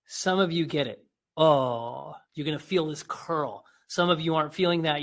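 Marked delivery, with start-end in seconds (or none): 1.4-2.1 one long falling AW; elongated "aw", dropping in pitch; drawn-out demonstration. Shown as a demonstration. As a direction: hold AW open as a vocal exercise. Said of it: "Now just say the word".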